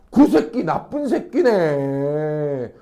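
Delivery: very drawn-out mock agreement; exaggerated sympathy. Friend backing up an upset classmate, drawn-out sympathizing. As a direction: stretch it out, taking the friend's side. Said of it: "동조해줘야지".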